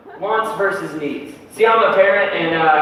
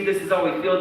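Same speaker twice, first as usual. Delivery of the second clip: normal talking voice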